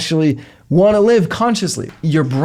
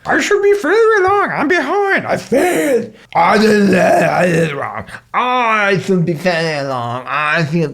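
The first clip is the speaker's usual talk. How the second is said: funny voice; 2 semitones higher than usual. Shown as a demonstration. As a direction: squeak the worries out like a cartoon. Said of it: "say them in a funny voice".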